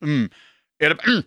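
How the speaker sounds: short, firm disapproving mm; a sharp grunt to stop the dog; a brief, hard hum of disapproval; forceful grunt. Shown as a demonstration. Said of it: "ett kraftigt".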